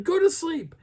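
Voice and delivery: high, loud mock order; mock a woman ordering dogs to sleep; high-pitched exasperated command; irate woman scolding. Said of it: "So she's like".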